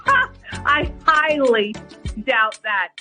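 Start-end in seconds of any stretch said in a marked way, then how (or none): none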